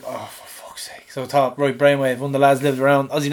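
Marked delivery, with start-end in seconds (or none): 0.0-1.1 slow whispered curse; whispered frustration; exasperated whisper. Retold or imitated. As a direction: breathe it out in defeat. Frustrated aside after failure.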